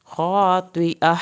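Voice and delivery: "خاطئہ" slow, ending on an audible h; audible final h. Shown as a demonstration. Teacher to students: slow, final h. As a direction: breathe the h at the end of "خاطئہ". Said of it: "آخر میں ہا کی آواز نکالیں".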